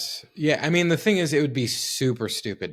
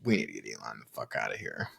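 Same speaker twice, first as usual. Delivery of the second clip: hushed, low leaked-audio quote; J D Vance; 13 semitones lower, 8 dB quieter, faster than usual. Retold or imitated. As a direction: drop low and quiet, like a secret recording. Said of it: "supposed leaked audio of J D Vance, like, saying".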